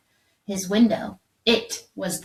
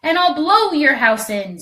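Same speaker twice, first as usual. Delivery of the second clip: loud, higher screaming threat; screaming wolf; 7 semitones higher, 8 dB louder than usual. A narrator's reading. Furious wolf screaming at the brick house. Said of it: "screamed the wolf".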